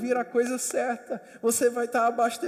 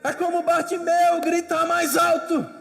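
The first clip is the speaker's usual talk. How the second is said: preaching at full force; 4 semitones higher, 9 dB louder than usual. Acted. Preacher exhorts the congregation to persevere, loud and rising. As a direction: preach it out to the hall.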